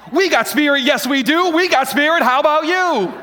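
loud, high chant; pep rally cheer. Acted. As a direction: fire up the crowd with a cheer.

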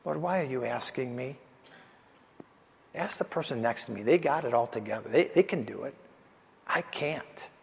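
a doubter quietly telling God to ask someone else; quiet self-doubt. Acted. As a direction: murmur it like a private fear. People doubting themselves, voiced.

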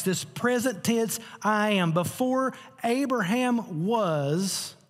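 slow, high, emphatic proclamation; preaching climax. Real, not acted. Preacher proclaiming to the church that Jesus is eternal, slow and exalted.

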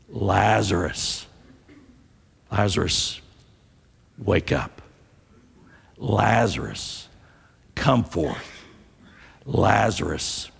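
authoritative command. Acted. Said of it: "with great authority in his voice".

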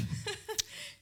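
light high giggle at a slip; high breathy laugh. Real, not acted. Wife laughing at the skipped slide, airy giggle.

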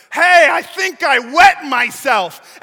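loud, slow hammed-up yell over the storm; mock yell. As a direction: bellow it across the boat, hamming it up. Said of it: "I kinda picture Andrew over here yelling to his brother".

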